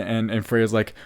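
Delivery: ordinary talk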